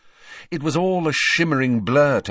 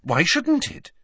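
anxious; 9 semitones higher than usual. Acted. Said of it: "said Hermione anxiously".

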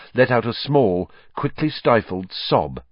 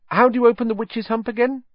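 put-on much higher girl's voice; Hermione; faster than usual. Hermione asking Harry about the hump, high girl's voice. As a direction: pitch up for Hermione's quick question.

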